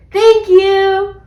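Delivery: high, loud, singsong thank-you; sustained, cheery children's thanks; delighted thanks. A narrator's reading. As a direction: call it out like grateful kids.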